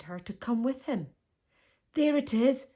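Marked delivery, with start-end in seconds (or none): 1.9-2.7 higher, louder hunter's cry; cried out. A narrator's reading. Hunter spotting the giraffe, loud higher cry. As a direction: call out spotting the prey. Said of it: "cried one of the men".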